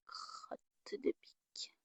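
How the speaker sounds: soft unvoiced crotte de pique under breath; whispered curse. Real, not acted. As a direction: mutter the mild curse to herself. Mutters in frustration, whispered.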